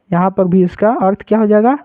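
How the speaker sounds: usual speaking voice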